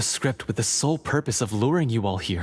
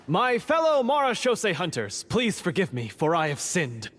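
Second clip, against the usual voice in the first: much higher, grandiose public address; overblown speech; 6 semitones higher than usual. Acted. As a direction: proclaim it like a hammy knight before a crowd. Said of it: "Tone it down".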